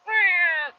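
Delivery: high, thin lion cub mew; Simba. Retold or imitated. Cub trying his first roar, feeble high squeak. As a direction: attempt a roar and manage only a squeak. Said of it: "whenever Simba's like".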